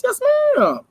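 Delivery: high, sassy squeal of agreement; sassy yes ma'am. Real, not acted. Sassy hype to the chat.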